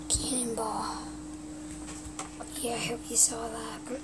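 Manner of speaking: soft unvoiced whisper. Real, not acted. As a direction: breathe it as a whisper. Whispering commentary.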